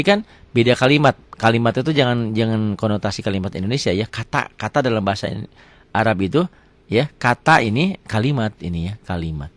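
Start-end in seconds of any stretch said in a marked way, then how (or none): none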